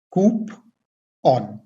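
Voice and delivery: कूपन split slowly, "KOOP ... on"; two separate parts in कूपन, long OO then open "on"; correct pronunciation. Shown as a demonstration. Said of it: "तो सही pronunciation इसका क्या होगा?". (कूपन का सही उच्चारण, "कूप... ऑन")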